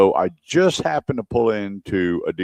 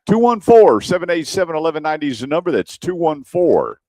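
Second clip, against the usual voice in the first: radio announcer; 6 semitones higher than usual. Real, not acted. Host throws to commercial with the number, announcer style. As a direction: sell the phone number before the break. Reads station number into the break.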